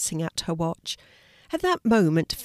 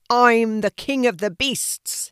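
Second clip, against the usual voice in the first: loud, slow, higher lion roar; 4 semitones higher, 5 dB louder, slower than usual. A narrator's reading. Lion asserting himself to the animals, roared. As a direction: proclaim it as a roaring lion. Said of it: "he roared".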